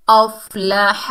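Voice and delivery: अफ़लाह recited clearly, हमज़ा joined to फ़ा; अफ़लाह with the hamza joined to fa; Quranic recitation. Shown as a demonstration. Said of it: "हमज़ा को फ़ा से मिलाएंगे". (तजवीद के साथ अफ़लाह)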